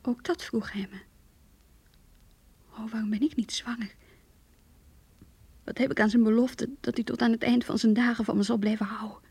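hushed, breathless diary whisper; barely voiced, holding back tears. Rachel whispering her grief to herself.

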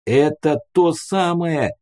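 drawn-out, high-pitched call. A narrator's reading. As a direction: call it across from a distance. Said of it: "крикнул я".

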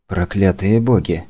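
usual speaking voice

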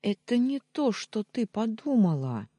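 high, loud denial; urgent protest. A narrator's reading. Heroine answering the jealous wife, urgent protest.

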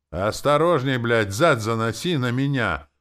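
loud, high hoarse yells; hoarse shouting. Acted. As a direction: soldiers bellowing while hauling. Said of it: "Раздались сиплые возгласы".

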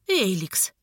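restrained, hostile greeting; mildly hostile, held-in; through clenched teeth. A narrator's reading. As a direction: squeeze the name out through the teeth. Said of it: "процедил сквозь зубы рыжий".

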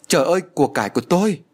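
high, loud, fast panicked cry. A narrator's reading. Rich woman finds her gold stolen, panicked loud cry. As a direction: shriek it as the treasure is gone. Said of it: "giọng của bà thất thanh".

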